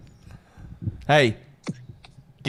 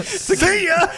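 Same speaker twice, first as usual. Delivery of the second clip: high, loud, laughing farewell; cheery mock goodbye; 5 dB louder than usual. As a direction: sing it out with a laugh. Dad mock leaving cheerfully.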